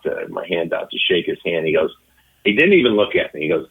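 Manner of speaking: normal talking voice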